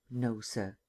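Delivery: soft, faint reply under his breath; a suspect's nearly inaudible denial; barely audible. Acted. Captain answers the district attorney, barely audible. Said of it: "His voice was barely audible".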